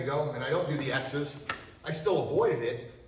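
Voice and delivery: ordinary talk, nothing marked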